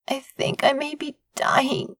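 slow, weak rasp. A narrator's reading. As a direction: rasp it out like you're dying of a hangover. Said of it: "I rasped".

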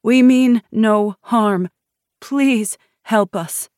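slow, high-pitched appeal for help; woman pleading. A narrator's reading. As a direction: plead slowly with the armed guards. Pregnant woman pleads, hands in air.

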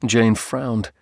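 ordinary delivery, nothing marked

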